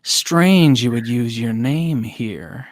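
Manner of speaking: slow, drawn-out suspicion; suspicious. Acted. Worker eyeing a newcomer who gave a name, slowly suspicious. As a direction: weigh each word as you question a stranger.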